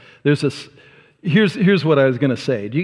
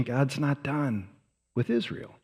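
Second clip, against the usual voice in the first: hushed emphasis; 10 dB quieter than usual. Acted. Sermon punchline.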